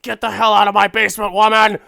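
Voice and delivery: fast, furious yell at his wife; angry yell. Retold or imitated. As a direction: bellow her out of the basement. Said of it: "he's just like".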